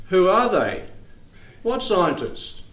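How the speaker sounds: a hint of indignant God; God's mock challenge. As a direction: play God unimpressed by the scientists. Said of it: "God would say".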